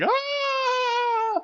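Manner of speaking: high mock scream of the victim. Retelling the trailer, the girl's high mock scream. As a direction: shriek like the girl in the trailer. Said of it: "She's like".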